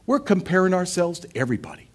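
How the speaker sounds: normal talking voice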